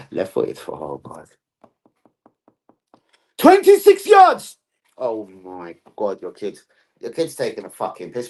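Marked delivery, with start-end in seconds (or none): 3.4-4.6 high, very loud, drawn-out yell; excited shout. Acted. Amazed at a goal from distance, excited shout. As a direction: holler it slowly at full volume.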